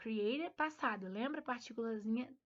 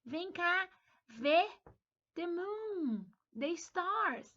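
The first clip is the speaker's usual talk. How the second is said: high, loud call from the window; mother calling her son outside, a playful high call; calling out to child; 7 semitones higher, 4 dB louder, much slower than usual. Retold or imitated. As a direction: call the child over to see the moon. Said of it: "Chamar na janela e falar".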